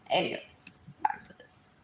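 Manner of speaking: hushed whisper; whispered aside. Real, not acted. Getting back to her list of topics, in a whisper. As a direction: mutter it to yourself.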